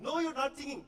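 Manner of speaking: very high, emphatic Indian waiter; Indian accent. Retold or imitated. Indian waiter refusing to believe the singer, Indian accent. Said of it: "this Indian waiter".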